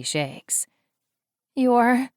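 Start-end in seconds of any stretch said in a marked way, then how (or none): 1.5-2.1 high, loud breathless shock; stunned at seeing a dead friend; shocked gasp. Acted. Woman sees dead friend alive.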